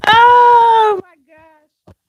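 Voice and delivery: very loud, high, drawn-out cry of amusement; laughing exclamation. Real, not acted. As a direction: wail it out after a laughing fit. Coming out of a laughing fit.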